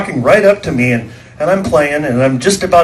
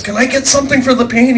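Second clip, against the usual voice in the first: pleading wife; 11 semitones higher than usual. Retold or imitated. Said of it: "my wife says".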